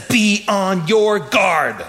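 slow, deliberate warning; solemn warning. Retold or imitated. Jesus warning the disciples, slow and grave. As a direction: weigh every word. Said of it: "he says".

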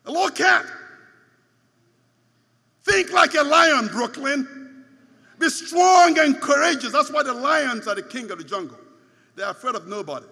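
loud rallying sermon call; fiery preaching. Acted. Preacher rallying Brooklyn to be strong, loud and fiery. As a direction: roar it to the congregation like a lion.

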